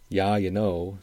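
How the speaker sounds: "Yeah" said as "yah", Upper Midwest style; lightly put-on rural Upper Midwest accent. Shown as a demonstration. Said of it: "this accent that he's using for the title".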